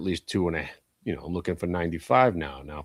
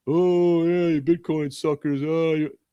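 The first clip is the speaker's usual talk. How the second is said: higher, jeering impression of online trolls; mock internet taunt; 7 semitones higher, 3 dB louder, slower than usual. Imitating internet trolls taunting silver holders, mocking. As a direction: sneer it like a smug troll.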